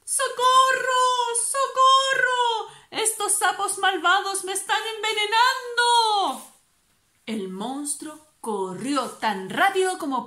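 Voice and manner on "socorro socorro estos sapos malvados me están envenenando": very high, loud, wailing panic; panicked cry for help. Acted. Poisoned monster screaming for help in a panic.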